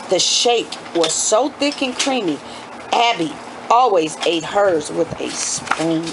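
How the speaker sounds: ordinary delivery, nothing marked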